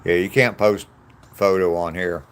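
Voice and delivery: usual speaking voice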